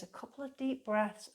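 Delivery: ordinary talk, nothing marked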